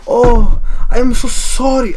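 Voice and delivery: high, drawn-out mock European accent; exaggerated foreign accent. Customer begging the cashier for free food, exaggerated European accent. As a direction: lay a foreign accent on thick, slow and pleading. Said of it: "I'm exaggerating his accent".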